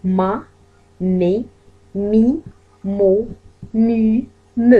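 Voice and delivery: slow, clearly sounded syllables ma mi mu; syllable drill. Shown as a demonstration. French teacher drilling syllables for students. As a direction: spell out each syllable for the class. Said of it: "teacher drills syllables".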